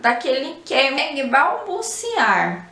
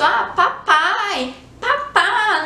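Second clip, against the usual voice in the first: put-on slow, much higher sing-song praise to a baby; higher, stretched parent repeating the baby's word; adult baby talk; 6 semitones higher, much slower than usual. Shown as a demonstration. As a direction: coo it back to the toddler like a proud parent. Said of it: "geralmente o adulto faz isso".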